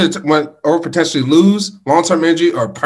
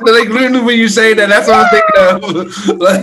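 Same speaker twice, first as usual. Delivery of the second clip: laughing; 6 semitones higher, 3 dB louder than usual. Real, not acted. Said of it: "host laughing at his own bit".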